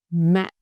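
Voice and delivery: "Mẹ" clipped short and dropped low; short low nặng tone. Shown as a demonstration. Teacher drilling the low nặng tone on "Mẹ". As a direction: drop "Mẹ" down and stop it dead. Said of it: "nặng tone, short and low".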